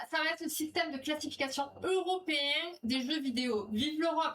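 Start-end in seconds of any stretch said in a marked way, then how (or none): 3.7-4.3 high-pitched mock-patriotic cheer; playful cheer. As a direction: cheer it out brightly for fun.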